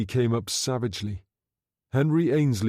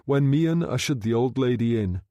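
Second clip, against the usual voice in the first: ordinary delivery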